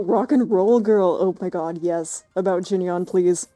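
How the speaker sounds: ordinary talk, nothing marked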